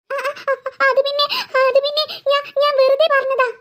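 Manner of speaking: halting, nervous excuse; fearful, stumbling over the words; scared stammering. Acted. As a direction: stutter and restart in acted fright.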